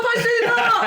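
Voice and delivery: loud, high eager shout; excited shout. Real, not acted. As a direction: repeat it with a yell. Repeated louder.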